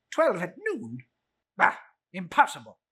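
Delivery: Scrooge jolted awake in disbelief; startled old Scrooge. Acted.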